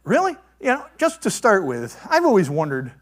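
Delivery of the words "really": incredulous. Real, not acted. Preacher incredulous at golden calf.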